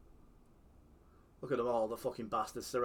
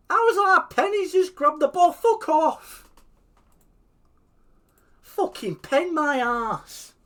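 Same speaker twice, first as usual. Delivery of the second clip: much higher, much louder outraged yelling; furious rant; 14 dB louder, slower than usual. Real, not acted. Raging at a penalty given against him, yelling. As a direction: yell at the ref.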